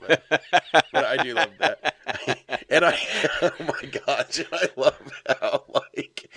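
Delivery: laughing while talking; real laughter breaking through the words. Real, not acted. Laughing at a favorite joke.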